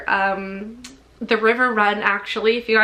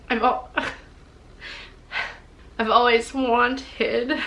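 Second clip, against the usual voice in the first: tearful, breaking voice; crying through happy news; ugly crying; 3 dB quieter than usual. Real, not acted. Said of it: "I'm literally, like, ugly crying right now".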